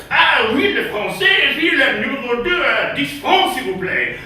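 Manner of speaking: high, loud mock French opening, dropping at the end. French counter server taking a menu order, exaggerated French. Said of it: "whenever you see the French".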